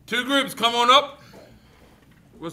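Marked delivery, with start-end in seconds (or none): 0.1-1.2 loud, raised-pitch summons; calling out. Real, not acted. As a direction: call both groups up loudly. Calling two groups up.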